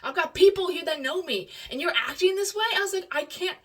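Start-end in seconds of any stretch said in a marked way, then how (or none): 0.0-2.6 loud, higher angry scolding; mad. Retold or imitated. Imitating partner telling her to stop embarrassing him, mad. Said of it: "he got mad. He's like". 3.1-3.6 high, pleading retort; exasperated protest. Retold or imitated. Retelling her answer to angry partner, exasperated protest. Answering back in retold quarrel.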